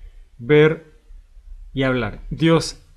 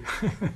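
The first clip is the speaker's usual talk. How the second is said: short laugh; 8 semitones higher, 8 dB quieter than usual. Real, not acted. Preacher laughs at own joke.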